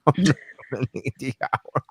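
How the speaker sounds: lightly voiced cocky client; mock confident client. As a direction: say it like a smug customer. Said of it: "And they're like".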